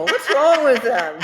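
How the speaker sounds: slow, laughing imitation of a daughter; amused retelling. Mother quoting her daughter, amused and laughing. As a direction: draw it out through laughter.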